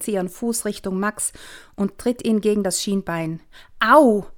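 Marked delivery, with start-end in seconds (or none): 3.8-4.3 sharp loud startled ouch; startled cry. Acted. Father yelping at breakfast, startled loud cry. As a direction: yelp out in surprise. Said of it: "ruft der Vater erschrocken".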